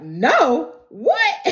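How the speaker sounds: mock astonishment leaping very high; shocked disbelief. Everybody reacts to the refusal, high-pitched mock shock. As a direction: imitate a stunned crowd, pitch shooting up on WHAT.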